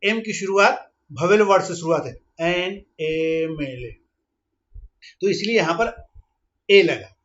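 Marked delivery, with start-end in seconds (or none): 2.4-4.0 very slow, letters drawn out; slow motion. Shown as a demonstration. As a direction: spell it out slowly. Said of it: "तो यहाँ पर भी देखिए".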